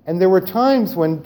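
usual speaking voice

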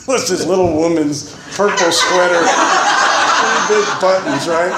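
high words broken by laughter; laughing at the purple sweater. Real, not acted. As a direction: tell it cracking up at yourself.

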